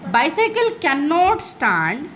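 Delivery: very slow, word by word; slow demo read. Shown as a demonstration. Teacher reading the pun slowly to students. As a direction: spell it out slowly for the class. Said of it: "मैं ऐसे बोलूँगी".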